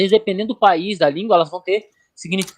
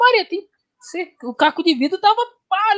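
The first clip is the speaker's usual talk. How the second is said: retold alarm; 10 semitones higher than usual. Husband telling Maria there was glass in his food, mock alarm. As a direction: replay telling Maria about the glass, pitched well up. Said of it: "Eu cheguei pra Maria e falei".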